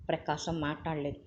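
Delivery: usual speaking voice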